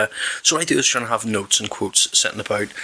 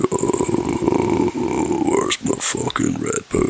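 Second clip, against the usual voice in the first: hungover groan; much slower than usual. Pretend. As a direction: moan it like waking up hungover. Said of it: "do the whole".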